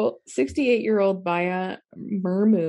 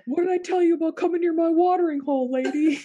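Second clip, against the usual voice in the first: angry elephant voice; 7 semitones higher than usual. Pretend.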